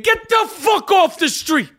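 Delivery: put-on angry old Brooklyn pizza man yelling; furious pizzeria owner shouting. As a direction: bellow it slow like a furious shop owner. Sal character rant.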